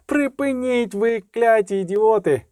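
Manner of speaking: high, shrill shriek telling them to stop. Acted. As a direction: screech at the two fools to stop. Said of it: "верещав Любисток". (вереск, високий крик на двох дурнів)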